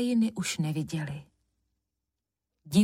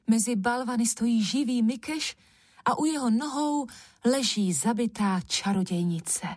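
ordinary delivery, nothing marked

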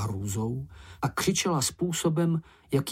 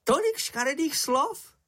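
very high, squeaky marvelling; admiring amazement. A narrator's reading. Čenich impressed by the insults, high admiring cry. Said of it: "volal Čenich s obdivem".

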